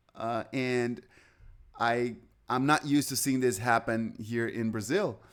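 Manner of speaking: ordinary delivery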